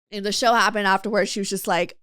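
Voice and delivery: usual speaking voice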